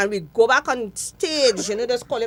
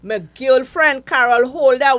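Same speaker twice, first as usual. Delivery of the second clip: ordinary delivery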